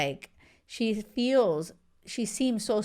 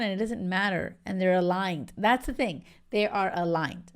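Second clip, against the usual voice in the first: normal talking voice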